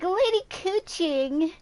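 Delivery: high, slow mimicry of a game character; mock the way they say it, stretched out and high; drawn-out high impression of a character's line; imitated game character. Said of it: "They're like".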